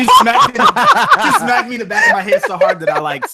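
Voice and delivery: high, loud, laughing retelling; laughing while telling of mom's smack; laughing excitedly. Real, not acted. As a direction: burst out laughing at the punchline. Laughing at the story climax.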